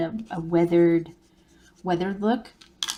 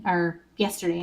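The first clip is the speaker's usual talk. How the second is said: usual speaking voice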